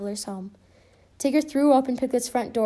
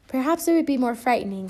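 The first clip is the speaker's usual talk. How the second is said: usual speaking voice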